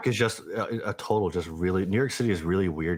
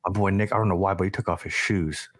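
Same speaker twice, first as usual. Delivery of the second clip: ordinary talk, nothing marked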